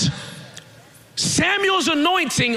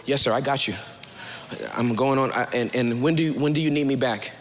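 low, soft-spoken dutiful son; David's obedient response; 11 semitones lower, 4 dB quieter, faster than usual. Acted. David accepting his father's errand, low and deferential. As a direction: answer Daddy promptly, low and humble.

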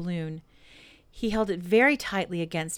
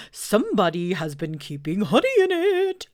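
Owl voice; 3 semitones higher, 4 dB louder than usual. Acted. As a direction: boom it out like a pompous owl. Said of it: "said Owl".

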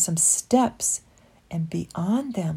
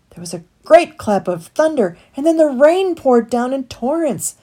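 ordinary delivery

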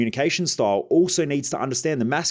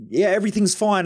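lightly mimicking a breezy burnt-out employee; mock cheery staffer; 8 semitones higher than usual. Burnt-out staff member claiming all is fine, mock cheery.

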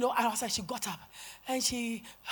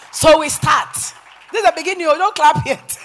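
high, very loud preacher's call; excited shout; 7 semitones higher, 14 dB louder than usual. Real, not acted. Preacher holding off the congregation's applause, excited shout.

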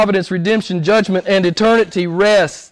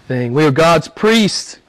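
usual speaking voice

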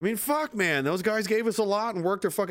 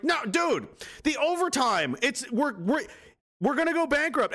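high, anxious protest; mock panicked official; 10 semitones higher than usual. Officials panicking over overtime costs, high mock protest. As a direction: plead about the overtime.

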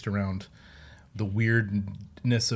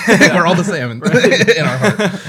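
high, loud laugh through the words; 9 semitones higher, 13 dB louder than usual. Real, not acted.

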